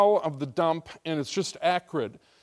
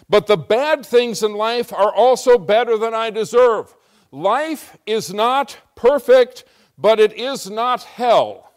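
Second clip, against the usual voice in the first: louder, slower emphatic preaching; 5 semitones higher, 7 dB louder than usual. Real, not acted. Pastor preaching to the church, forceful and slow. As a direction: drive the point home to the congregation.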